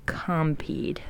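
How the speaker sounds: breathy whispered Compeed; whispering. Shown as a demonstration. Recording the word to reverse.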